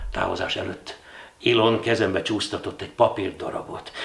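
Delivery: usual speaking voice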